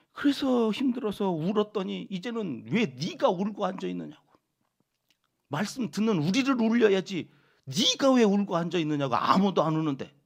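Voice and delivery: loud, high indignant rebuke; mock scolding. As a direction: berate the weeping prophet. Voicing hostile listeners' scolding. (우는 예레미야를 나무라는 청중 흉내, 큰 소리로)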